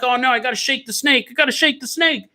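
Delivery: high-pitched mock protest; imitated movie character. Movie man peeing as zombies near, imitated protest.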